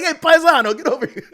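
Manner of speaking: high, loud hammed-up Italian accent; mock Italian accent, shouting; Italian grandpa. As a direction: call him over like a loud Italian grandpa. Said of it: "He's like".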